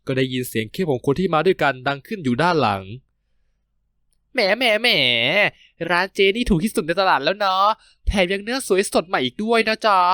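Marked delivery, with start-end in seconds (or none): 3.6-10.0 drawn-out, higher, wheedling flattery; butter up the seller, stretching every word; flattering sweet talk. Acted. Marut charming the pork seller for a discount, drawn-out flattery.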